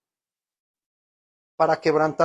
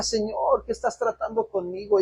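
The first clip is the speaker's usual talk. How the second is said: tearful plea; 7 semitones higher than usual. Retold or imitated. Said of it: "yo llorando y le decía".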